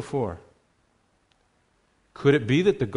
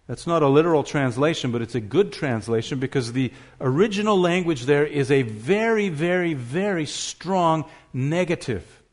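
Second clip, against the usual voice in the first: usual speaking voice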